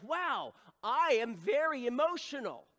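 high, loud mock revelation; emphatic, loud self-declaration; acted realization. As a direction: announce it like a big discovery. Acting out test-taker's proud realization.

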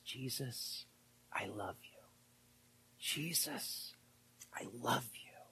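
soft, hushed whisper of devotion; whispered confession. Real, not acted. Preacher reliving his confession to Jesus, whispered. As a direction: whisper it like a prayer.

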